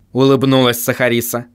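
ordinary delivery

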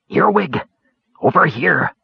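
urgent hushed call from the shadows; smothered cry. A narrator's reading. Caramon calling Earwig from the alley, urgent. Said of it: "a smothered cry".

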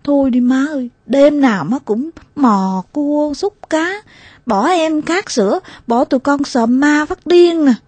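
put-on sulky child berating mom; bitter reproach. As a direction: nag her resentfully, near tears. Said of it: "đay nghiến má".

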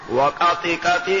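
"وقط قط" with short i after each ط; kasra without tanween. Shown as a demonstration. Teacher showing the second variant, kasra without tanween. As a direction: end each "قط" as "qati", with no n sound. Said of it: "بالكسر بدون تنوين".

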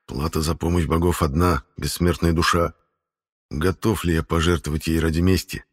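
ordinary delivery, nothing marked

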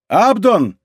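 mild narrated yell; calling out. A narrator's reading. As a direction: call down to someone through the window. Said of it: "закричал Планше в окно".

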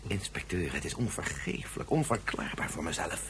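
low, soft, full of remorse; low remorseful. Acted. Scientist quietly begging the inspector, remorseful. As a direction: sink into the guilt, quietly.

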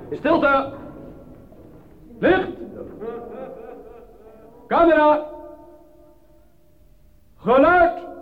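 calling out orders. Real, not acted. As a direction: bark each cue up and out across the set. Director calls start of take.

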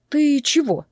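high-pitched puzzled question; surprised. A narrator's reading. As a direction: ask it taken aback, pitch up. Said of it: "удивился он".

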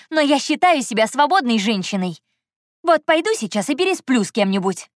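high-pitched indignant tirade; furious. A narrator's reading. Heroine declares her freedom to jealous Andrey, furious. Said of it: "я не на шутку рассвирепела".

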